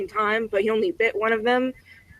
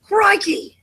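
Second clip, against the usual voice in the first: Steve Irwin; 8 semitones higher than usual. Acted. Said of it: "Talk about Steve Irwin".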